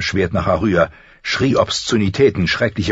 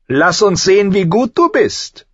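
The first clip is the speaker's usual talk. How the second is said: high, loud taunting prince; gleeful challenge; 12 semitones higher, 5 dB louder than usual. A narrator's reading. Prince eager to fight the frightened boy, gleeful challenge. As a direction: goad him, voice raised high. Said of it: "seine Augen leuchteten vor Freude".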